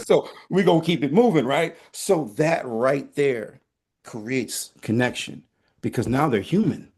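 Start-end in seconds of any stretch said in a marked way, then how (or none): none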